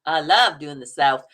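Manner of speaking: slow, low Southern drawl; Southern accent. Shown as a demonstration. Asked about Southern accents, answers in a Southern drawl.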